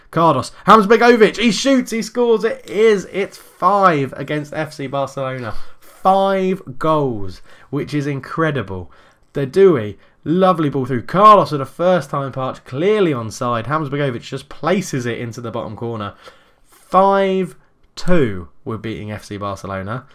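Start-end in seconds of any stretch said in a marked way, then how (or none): 0.1-3.1 loud, much higher goal shout; goal call. Real, not acted. Goal scored in the game. 3.1-16.1 brisk running match commentary; play-by-play commentary. Acted. Live football game commentary. 16.6-18.5 loud, higher score call; score shout. Real, not acted. Announcing the new score.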